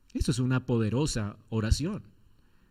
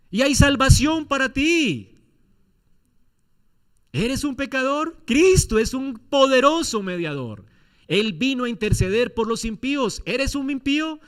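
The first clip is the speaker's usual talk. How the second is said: slow, loud and high proclamation; booming pulpit voice; full-force preaching; 9 semitones higher, 7 dB louder than usual. Acted. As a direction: proclaim salvation to the crowd.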